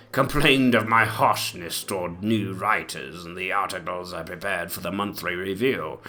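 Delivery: ordinary delivery, nothing marked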